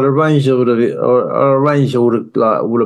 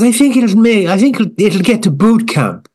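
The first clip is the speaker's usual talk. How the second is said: high, gushing judge voice; mock Louis Walsh; 9 semitones higher than usual. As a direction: gush at the wallpaper like a star judge.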